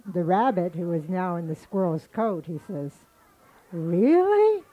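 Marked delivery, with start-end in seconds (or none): 3.7-4.6 high, loud mock astonishment; feigned surprise. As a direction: act shocked, pitch shooting up. Disguised rabbit feigns surprise.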